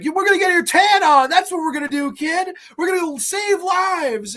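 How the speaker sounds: high cartoonish cool-guy voice, slowing at the end; mock Val Kilmer party voice. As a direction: hype the kid up like a party host. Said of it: "And he's like".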